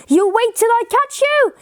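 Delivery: high-pitched storybook yell; high, contained shout of a man giving chase; yelled threat. A narrator's reading. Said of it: "yelled the man".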